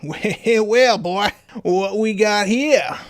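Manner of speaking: hammed-up American drawl, pitched high; slow, gloating mock-American accent. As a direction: play the rival in a put-on American accent. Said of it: "giving Claude here a American accent".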